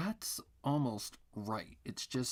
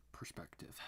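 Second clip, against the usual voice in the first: whispered; 4 semitones lower, 10 dB quieter than usual. Real, not acted. Dreaded magic word muttered.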